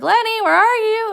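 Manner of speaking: high, loud searching call. Acted. Candy walking into the barn, calling for Lenny. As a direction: sing out as if looking for someone. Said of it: "calls for Lenny".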